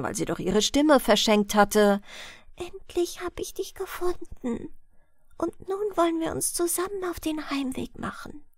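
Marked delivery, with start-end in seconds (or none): 2.6-8.4 put-on voiceless, higher whisper of a girl who lost her voice; girl whispering to her found friend; soft whisper. A narrator's reading. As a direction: breathe it out softly, no voice at all. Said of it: "sagte leise, denn laut konnte sie nicht sprechen".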